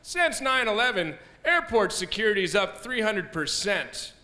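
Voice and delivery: slow, dramatic news read; news anchor. Retold or imitated. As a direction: read it like a grave newscaster. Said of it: "They'll be like".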